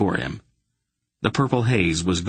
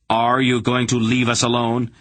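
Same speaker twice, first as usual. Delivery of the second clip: stern demand; 5 semitones higher, 4 dB louder than usual. A narrator's reading. Garion threatening the hierarch.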